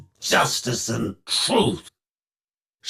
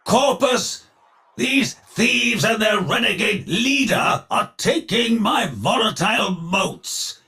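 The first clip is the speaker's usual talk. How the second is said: high, declaimed alarm to his forces; rally the army, voice pitched well up; high-pitched indignant command; calling to the troops; 8 semitones higher than usual. Acted. Villain summons his forces.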